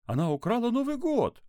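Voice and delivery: put-on very high, loud child's exclamation; shocked gasp. A narrator's reading. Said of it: "ахнул Максим".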